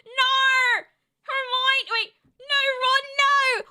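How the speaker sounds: dramatic movie heroine. Acted. Streamer acting a Harry Potter scene, hammed-up cry. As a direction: cry out as if your friend is about to die.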